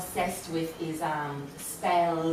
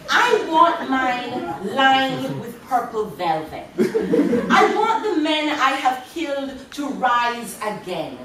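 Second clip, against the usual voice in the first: louder, slow, laughing declaration; triumphant climax; 8 semitones higher, 9 dB louder, slower than usual. Acted. Poet building the poem to a loud laughing climax. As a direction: proclaim it with wicked glee.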